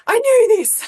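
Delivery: high, loud "I knew this", knew punched; exasperated outburst. Retold or imitated. To sister when the printer fails, a loud exasperated cry. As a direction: blurt it out, fed up and vindicated.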